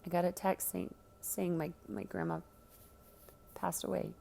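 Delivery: soft, halting stutter, close to tears; stuttering through tears. Acted. Boy telling his friend his grandma passed away, soft tearful stutter. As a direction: stammer it out softly, barely holding together. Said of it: "shaking again and stuttered out".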